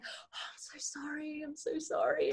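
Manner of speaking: hushed, apologetic whisper; mock parent whispering sorry; embarrassed whisper. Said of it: "the parent will usually be like".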